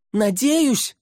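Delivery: very high, shouted disbelief; incredulous echo. A narrator's reading. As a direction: shriek it back as an alarmed question. Alarmed echo from below.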